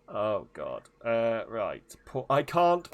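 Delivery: ordinary talk, nothing marked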